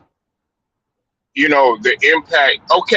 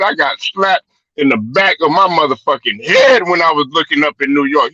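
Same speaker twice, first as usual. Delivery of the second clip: ordinary delivery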